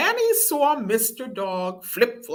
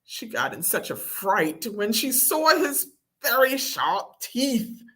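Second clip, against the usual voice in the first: usual speaking voice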